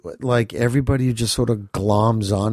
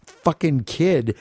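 ordinary delivery

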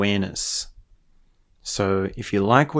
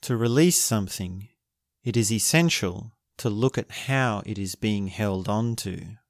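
guided meditation; 5 dB quieter than usual. Acted. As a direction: guide them gently into stillness.

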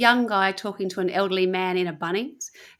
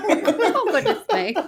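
mock shock; 10 semitones higher, much slower than usual. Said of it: "And I'm like".

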